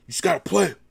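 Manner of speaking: barking drill sergeant voice. Retold or imitated. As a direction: bark it like a drill instructor. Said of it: "he sound like a drill sergeant".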